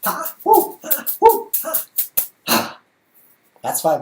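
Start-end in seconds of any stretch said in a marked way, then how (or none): 0.0-2.8 high, loud cries of triumph; triumphant whoops. Real, not acted. Juggler cheering himself on after landing five balls, whooping loud and high. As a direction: cheer each catch at the top of the voice.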